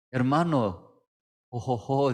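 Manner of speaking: imitating the pastor's easy ho-ho laugh; lightly voiced, cheerful chuckle; mock jolly laugh. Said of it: "se ríe".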